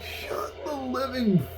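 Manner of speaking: strained scolding. Real, not acted. Scolding barking dogs.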